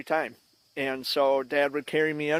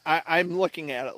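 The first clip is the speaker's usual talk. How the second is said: usual speaking voice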